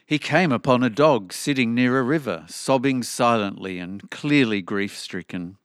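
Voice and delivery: usual speaking voice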